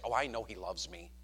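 quietly certain, in a low voice; wife's assured reply. Shown as a demonstration. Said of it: "would she say it like this".

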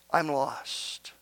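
low, very soft echo of the cry; hushed despair. Retold or imitated. Preacher echoes the cry of those without Christ, hushed. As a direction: let the cry sink down low and quiet. Same cry, dropped to a hush.

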